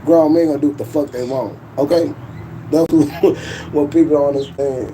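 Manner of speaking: ordinary delivery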